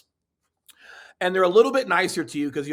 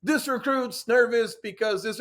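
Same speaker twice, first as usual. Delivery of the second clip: higher, slow, stumbling recruit voice; nervous stumbling recruit; 6 semitones higher than usual. Retold or imitated. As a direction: trip over the words like a scared recruit. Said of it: "I'm stumbling over my words".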